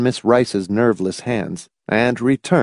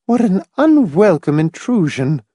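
put-on high, peevish old lady; pettish; 9 semitones higher, 3 dB louder than usual. A narrator's reading. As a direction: Mrs Pritchett is annoyed by the plane, peevish. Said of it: "brows drawing pettishly together".

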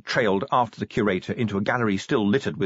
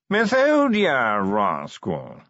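posh yawning drawl; much slower than usual. Acted. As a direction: drawl every word like a yawn. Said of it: "modulated yawning".

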